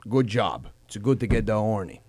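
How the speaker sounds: put-on low, quiet accented dad; imitated father. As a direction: confide it softly, low, as the proud father. Voicing father praising his son.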